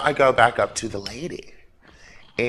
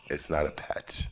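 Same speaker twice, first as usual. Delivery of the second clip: whispered aside; 9 semitones lower, 8 dB quieter than usual. Real, not acted.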